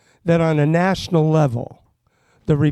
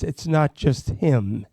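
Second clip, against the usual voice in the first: usual speaking voice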